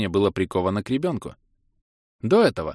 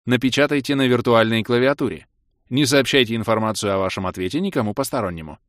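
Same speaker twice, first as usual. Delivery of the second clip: размеренный официальный голос автоинформатора, чуть приподнятый; объявляй как игровая система; системное объявление; 3 semitones higher, 4 dB louder than usual. Acted. (официальное системное сообщение)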